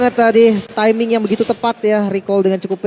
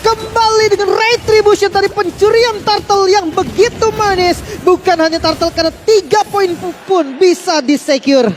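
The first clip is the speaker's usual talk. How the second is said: much higher, louder hype commentary; esports caster; 11 semitones higher, 5 dB louder than usual. Real, not acted. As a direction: ride the excitement of the steal. Co-caster hyped after turtle steal.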